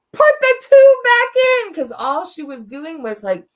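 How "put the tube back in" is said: much higher and louder, a hammed-up cry; mock exasperated outburst. Daughter at her mother's ICU bed, mock exasperated outburst. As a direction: blurt it out like a joke of despair. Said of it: "I was like".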